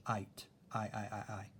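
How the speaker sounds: "ite, I, I, I" with a long eye vowel; long I sound. Shown as a demonstration. Teacher sounding out the long I for students. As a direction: stretch each "I" like "eye". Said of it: "That's my long I".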